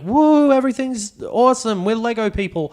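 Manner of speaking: very high, forced cheery whoop; mock crowd-pleasing hype. Presenter playing it up for the crowd, mock cheering. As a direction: fake giddy excitement in parody. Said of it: "play it up for this shit crowd".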